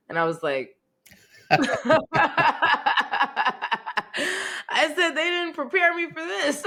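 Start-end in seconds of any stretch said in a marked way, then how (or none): 1.5-4.7 high delighted laugh bursting out; burst of laughter. Real, not acted. Laughing at her son's blunt answer, real laughter. 4.7-6.6 high, laughing through the words. Real, not acted. Mother laughing at her son's question, laughing through it.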